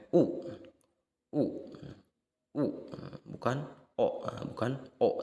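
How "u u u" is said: "U" with rounded lips pushed forward; vowel u demo. Shown as a demonstration. Teacher showing students the rounded vowel, lips forward. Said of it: "Eh, bukan O, ya".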